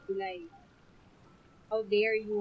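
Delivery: ordinary talk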